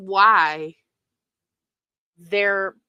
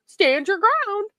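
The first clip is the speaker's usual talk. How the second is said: much higher, hammed-up fake concern; mock gushing sympathy; 8 semitones higher than usual. Mimicking hypocritical fans coddling Nella, mock gushing. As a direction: gush like a phony fan, overdoing it. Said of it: "where they would say".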